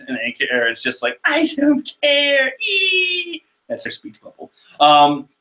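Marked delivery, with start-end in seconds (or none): 1.2-3.4 high whiny Carrot. Acted. Voicing Carrot's grief.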